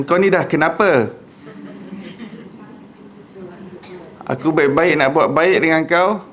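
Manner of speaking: exasperated rebuke at a clumsy rider; hot-tempered retort. Retold or imitated. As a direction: snap back like a short-tempered man. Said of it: "Kalau orang yang panas baran".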